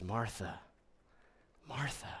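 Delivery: soft call, second "Martha" whispered; Jesus tenderly calling Martha, fading to a whisper. Retold or imitated. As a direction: whisper her name like Jesus comforting her. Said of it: "full of tenderness".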